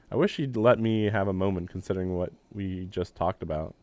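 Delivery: usual speaking voice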